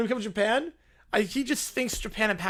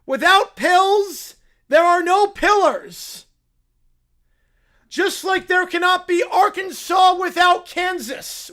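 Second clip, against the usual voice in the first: slow, grave, higher pronouncement of "Without pills, there are no pillars"; slow solemn pitch; 7 semitones higher, 10 dB louder than usual. Acted. Caillou pitching his idea in slow grave tones. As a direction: deliver it like a solemn sales pitch, slowly. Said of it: "That's his entire pitch".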